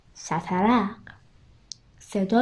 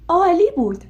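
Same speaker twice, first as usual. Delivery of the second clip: higher, louder joyful burst; excited; 8 semitones higher, 6 dB louder than usual. A narrator's reading. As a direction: ring out with pure delight. هیجان هری هنگام پرواز. (هیجان شاد و بلند)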